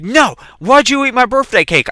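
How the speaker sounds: high-pitched accusing demand; mock angry interrogation; retold yelling accusation. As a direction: imitate an irate sister demanding answers. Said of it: "started yelling at me".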